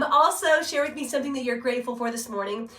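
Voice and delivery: ordinary talk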